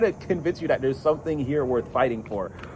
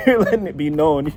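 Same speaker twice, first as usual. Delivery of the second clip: ordinary talk, nothing marked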